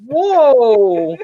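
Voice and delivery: retelling his own gasp of surprise; mock shocked whoa. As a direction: react like you can't believe it. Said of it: "I'm like".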